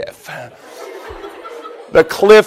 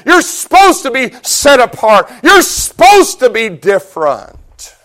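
raised, rhythmic repeated exhortation; forceful preaching; 5 semitones higher, 5 dB louder than usual. Real, not acted. Preacher calling believers to be set apart, exhorting forcefully. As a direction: hammer each parallel phrase home.

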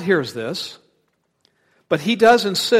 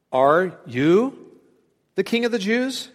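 surprised question; 4 semitones higher than usual. Retold or imitated. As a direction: Pilate asks Jesus, surprised, high rising pitch. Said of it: "carries this tone of surprise".

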